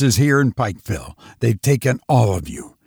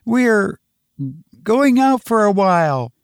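high-pitched, uneasy mother's voice; wavering call; 9 semitones higher than usual. A narrator's reading. Mother calls up to the twins, high and wavering. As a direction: call upstairs in a high, uncertain voice. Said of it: "Janet called in a wavering voice".

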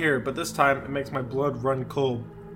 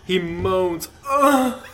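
mock moan; 5 semitones higher, 5 dB louder, much slower than usual. Comic reading of horror story.

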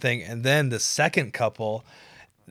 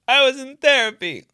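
much higher, louder whiny plea; whiny defendant; 13 semitones higher, 10 dB louder than usual. Pretend. Defendant pleading before the peers, high whiny plea. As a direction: plead your case pathetically. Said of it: "Why don't we execute you?".

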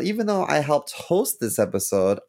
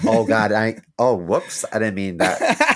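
normal talking voice

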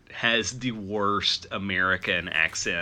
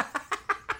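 breathy laugh; 9 dB quieter than usual. Real, not acted. Laughing at own quote.

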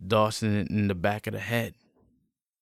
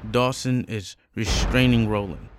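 usual speaking voice

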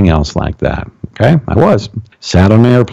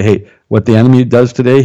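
ordinary delivery

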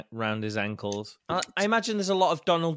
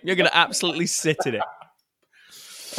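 loud; 4 semitones higher, 8 dB louder than usual. Real, not acted. Refusing to edit it out.

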